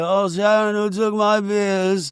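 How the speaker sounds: high, drawn-out mock wail. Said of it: "wall to wall [name], the Osbournes clips".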